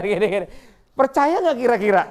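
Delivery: usual speaking voice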